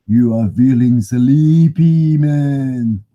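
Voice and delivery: slow, drawn-out hypnotist voice; mock hypnotist. Vlogger hamming up a hypnotist to viewers, slow. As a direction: lull them like a stage hypnotist.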